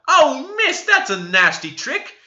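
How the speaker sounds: high and loud outcry; a pinched servant yelling at her mistress; screamed protest. A narrator's reading. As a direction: shriek it out in pain and outrage. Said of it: "screamed out".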